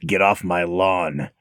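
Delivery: low, slow raspy grump; Clint. Acted. Host playing up his hoarse voice for co-host, Clint impression. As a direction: growl it out low and drawn out.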